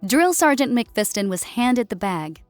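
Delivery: ordinary delivery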